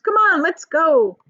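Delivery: slow, loud, keen call; rally everyone to go; eager chorus. Acted. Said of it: "they chorused".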